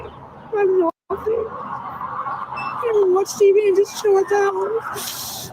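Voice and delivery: high, slow laughing through despair. Real, not acted. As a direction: laugh at how hopeless it is. Broke and despairing before Easter.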